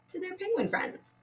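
ordinary talk, nothing marked

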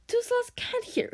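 high singsong little-dragon voice; singsong dragon taunt. A narrator's reading. Toothless pretends deafness to Hiccup, high singsong. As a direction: chant it up high, teasing. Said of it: "sang Toothless through a m".

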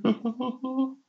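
high, put-on goofy giggle; mock chuckle. Mocking the "just friendly" crowd, high fake giggle.

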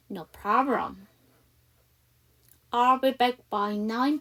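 slow, careful practice read; slow model dialogue. Shown as a demonstration. Teacher reading the dialogue slowly for students to copy. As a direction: model each line slowly and clearly. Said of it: "저랑 같이 한번 연습해 보도록 할게요".

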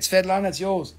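ordinary talk

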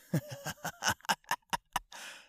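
shrill villain laugh; creepy high cackle. Retold or imitated. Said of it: "anime impression video".

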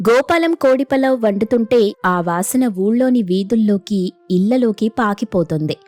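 plain reading voice

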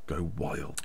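very low, soft deadpan; hushed, unexcited crowd report; deadpan understatement. Pretend. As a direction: drop right down in mock celebration. Claims crowd celebrates, said very quietly.

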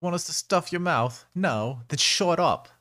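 loud, high British thug threat; British accent. Acted. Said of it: "decided to be British there for a second".

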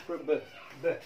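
normal talking voice

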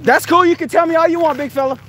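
high, loud sarcastic jab at the guard; mocking taunt. Real, not acted. Taunting the security guard on his way out, high and loud. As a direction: needle him, high and loud, all fake-friendly.

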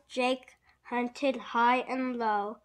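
ordinary delivery, nothing marked

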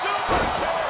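high, loud move call; excited shout. Acted. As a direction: shout the kick as it hits. Commentator calls big move.

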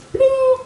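very high-pitched "ding"; idea ding. Acted. The man suddenly gets an idea, high imitated ding. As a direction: chime like a light bulb coming on.

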